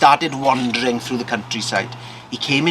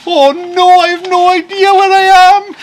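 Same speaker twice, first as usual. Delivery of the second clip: very high, loud cry of alarm; panicked wail; 12 dB louder than usual. Acted. Farmer panics above the clouds.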